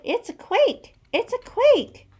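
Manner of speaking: very high, loud alarmed squawking; panicked shrieks. Acted. Animals panicking in chorus.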